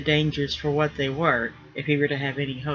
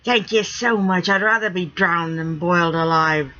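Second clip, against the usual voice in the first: wounded pirate rigger; 3 semitones higher, 4 dB louder than usual. Acted. Rigger protesting to his friend about the bath, aggrieved. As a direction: plead like a betrayed old salt. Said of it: "Sniff made a sour face".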